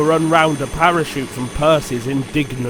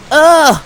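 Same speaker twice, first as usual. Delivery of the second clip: high, loud shuddering "ugh" as cold rain hits; shivering ugh; 12 semitones higher, 8 dB louder than usual. Acted. Little engine in cold rain, shivering ugh. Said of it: "he shivered".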